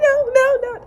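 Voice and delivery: high mock moan. Woman answering him mid-sex, squealing high. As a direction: squeal it playfully. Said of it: "She's like".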